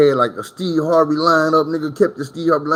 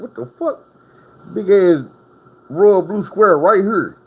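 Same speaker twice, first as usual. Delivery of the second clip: high startled outburst, then slower; mock shock; 6 semitones higher, slower than usual. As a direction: yelp it, then drag out the rest. Finding candy stuck in dreads.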